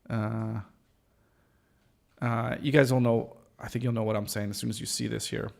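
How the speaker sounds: usual speaking voice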